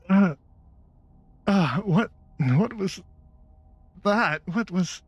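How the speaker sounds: high, halting, stunned voice; dazed npc. Acted. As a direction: sound struck and confused.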